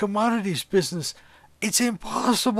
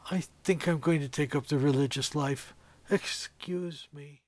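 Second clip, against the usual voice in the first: softer, trailing off; dazed withdrawal; 6 semitones lower, 6 dB quieter than usual. Acted. Stunned old trader excuses himself and drifts off, quiet daze.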